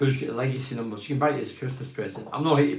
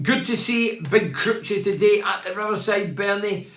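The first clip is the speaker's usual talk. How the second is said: higher, slow read-aloud voice; reading out a message; 6 semitones higher, 3 dB louder, slower than usual. Real, not acted. Host reads Rob's message about the Riverside, raised reading voice. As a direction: call out the listener's message deliberately.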